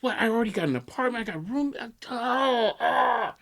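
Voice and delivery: hopeful student begging the dean, a mock sob breaking through; hammed-up despair. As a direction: overplay the pleading to the dean. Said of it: "best performance on a phone call".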